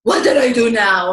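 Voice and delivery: mock defensive retort; defensive snap. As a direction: throw it back defensively. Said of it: "rather than".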